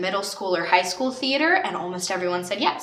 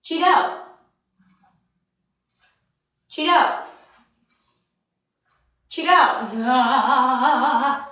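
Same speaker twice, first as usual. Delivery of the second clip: higher, repeated mock director's order; imitating the director; 5 semitones higher, 4 dB louder, much slower than usual. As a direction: parrot the director's nagging command. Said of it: "director's like".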